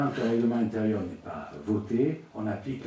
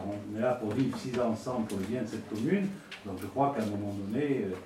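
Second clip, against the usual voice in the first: usual speaking voice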